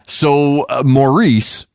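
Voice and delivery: ordinary delivery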